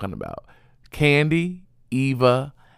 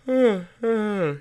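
long, drawn-out yawn, pitched high; a tired yawn slipping out mid-talk; sleepy yawn between sentences; real yawn; 11 semitones higher than usual. Real, not acted. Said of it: "excuse me, I'm yawning".

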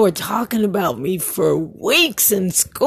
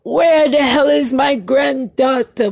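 retold scream; 5 semitones higher than usual. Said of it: "he starts screaming".

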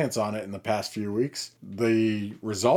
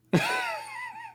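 soft high laugh; 5 dB quieter than usual. Real, not acted. Laughs at herpes joke.

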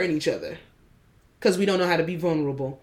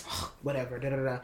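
dismissive eye-roll; 6 semitones lower, 11 dB quieter than usual. Retold or imitated. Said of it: "I'll be like".

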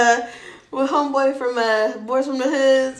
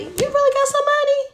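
high, incredulous question; mock disbelief; 3 dB louder than usual. Friend doubting the money boast, high-pitched disbelief.

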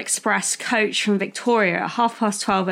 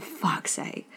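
very soft, breath-only swearing; exasperated muttered curse; 5 semitones lower, 11 dB quieter than usual. Retold or imitated. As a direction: curse under your breath, fed up. Retold muttered curse at sleeping passenger.

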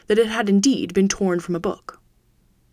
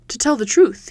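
ordinary delivery

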